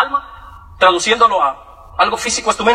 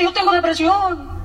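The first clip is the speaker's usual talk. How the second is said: high, slow, drawn-out whine of complaint; hammed-up sufferer parading an ailment; mock self-pity; 7 semitones higher, slower than usual. Comic speaker mimics people boasting of depression, mock-pitiful.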